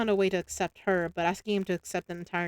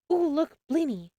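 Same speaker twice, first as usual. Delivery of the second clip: high, slow delighted squeal of discovery; light up at the sight of food; eager, higher and louder; excited; 8 semitones higher, 4 dB louder than usual. Acted. Spotting favourite food at stall.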